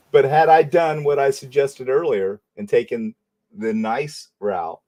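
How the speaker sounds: usual speaking voice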